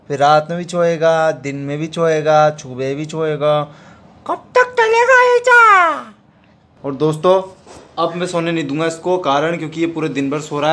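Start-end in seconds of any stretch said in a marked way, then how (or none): 4.3-6.1 squeaky pet voice. Real, not acted. Coaxing sleeping dog awake.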